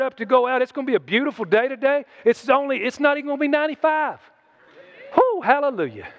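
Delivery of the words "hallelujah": usual speaking voice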